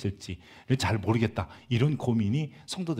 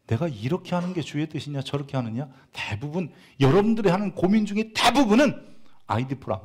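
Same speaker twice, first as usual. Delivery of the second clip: ordinary talk, nothing marked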